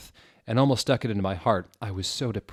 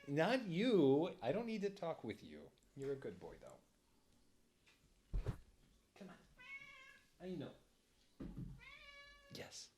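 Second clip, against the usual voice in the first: much softer, higher aside; aside to pet; 9 semitones higher, 16 dB quieter than usual. Real, not acted. Talking to the dog beside the mic, softly. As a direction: murmur kindly to the dog.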